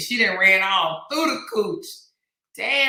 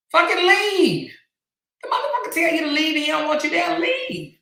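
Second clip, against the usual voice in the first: yelling leave; 10 semitones higher, 3 dB louder than usual. Real, not acted. Ranting to listeners about trespassers, shouting. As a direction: yell it like you mean get out.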